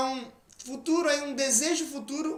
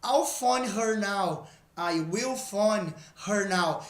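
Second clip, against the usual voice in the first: slow, clear example read; slow teacher demo of contraction and full form; slow model sentences; much slower than usual. Shown as a demonstration. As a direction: say each form slowly for students. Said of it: "O exemplo nosso diz isso".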